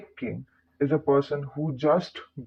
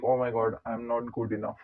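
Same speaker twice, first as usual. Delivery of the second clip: dropped low and soft, a defeated sigh of a line; soft self-doubting murmur alone at night; despairing inner voice; 5 semitones lower, 8 dB quieter than usual. Acted. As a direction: let it sink, quietly hopeless. Fake king alone at night.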